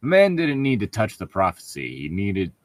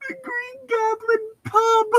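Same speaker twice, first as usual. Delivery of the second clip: very high, slow put-on voice; high drawn-out mock villain; much slower than usual. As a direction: savour the name like a villain.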